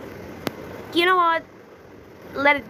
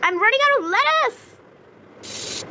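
high, exasperated pleading; 8 semitones higher, 3 dB louder, slower than usual. Real, not acted. Gamer running out of lettuce, high exasperated whine. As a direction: whine it up high at the game, fed up. Ingredients keep disappearing.

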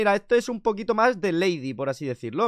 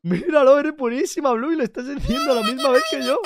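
high, loud delighted cheer; thrilled, raised-voice praise; amazed cheering; 8 semitones higher, 5 dB louder than usual. Pretend. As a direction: cheer on the little dancer in amazement. Cheering Bluey dancing in sync.

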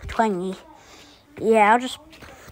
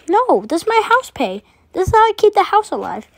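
defensive; 8 semitones higher, 4 dB louder than usual. Acted. Defending the house money loudly.

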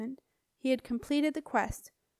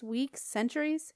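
ordinary delivery

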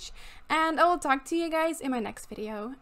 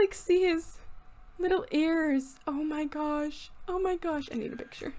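normal talking voice

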